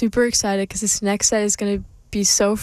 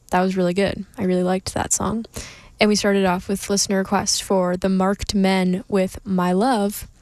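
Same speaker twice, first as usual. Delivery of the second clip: ordinary delivery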